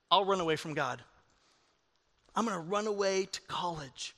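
low, hushed musing to oneself; quiet inner thought. Acted. A churchgoer secretly planning to run from God, quiet inner thought. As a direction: think it aloud, softly and low.

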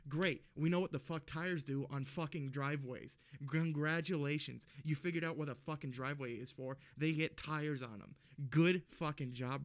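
to the homeowner, loud sarcastic scorn; sarcastic rant. Retold or imitated. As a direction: sneer it back at him. Sarcastic retold reply to homeowner.

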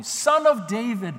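loud, high-pitched call; hollering. Acted. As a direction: holler out over the crowd. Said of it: "He hollered even more loudly".

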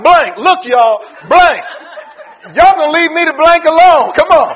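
loud, laughing fake curse; mock cussing. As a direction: Peter losing it, played for laughs. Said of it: "He was like".

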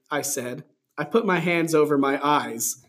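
normal talking voice

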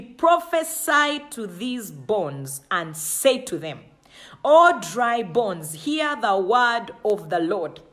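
slow, loud prophetic call; drawn-out preacher's declaration; booming proclamation. Acted. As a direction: proclaim it over the valley, slowly. God's command to prophesy.

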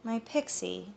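soft, sad little reply; a soft, let-down "My pixie"; sadly. Acted. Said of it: "Ada said sadly".

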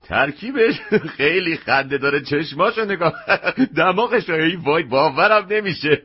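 talking through bursts of laughter; can barely get the words out for laughing; laughing hard. Acted. Customer laughing at the funny mask.

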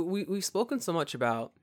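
ordinary delivery

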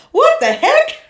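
startled. Retold or imitated. Conductor quote, big pitch jump.

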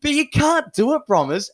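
high, loud indignant protest; mock outrage. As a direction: scold an absent mate with hammed-up dismay. Mock outrage at absent teammate.

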